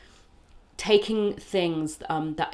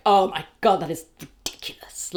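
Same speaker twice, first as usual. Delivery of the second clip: loud outburst dropping into a whisper; exasperated whisper after a loud start; mock cross; 3 dB louder than usual. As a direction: act incensed, then finish in a hiss. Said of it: "I'm so cross and being like".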